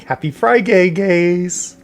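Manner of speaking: high, loud, bubbly greeting; cheery greeting. Retold or imitated. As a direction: sing out a happy greeting.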